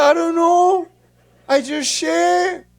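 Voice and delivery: high, loud, drawn-out mock wail; exasperated shout. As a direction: play a cornered son protesting helplessly. Acted quarrel with his mother.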